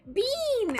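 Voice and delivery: high, loud call to a pet. Real, not acted. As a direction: holler the name in a high voice. Calling out to the pet.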